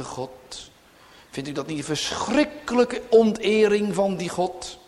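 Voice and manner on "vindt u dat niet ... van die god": normal talking voice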